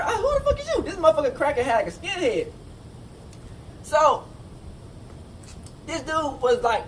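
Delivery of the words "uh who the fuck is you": high, laughing disbelief; incredulous. Retold or imitated. To the skinhead in the cell, high laughing disbelief. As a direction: act like you can't believe who's asking. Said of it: "I had to remember".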